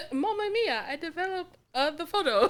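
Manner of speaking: high, hammed-up Italian Mario voice with laughter; a laughing fake Italian Mario accent; laughing mock Mario. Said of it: "Mario accent".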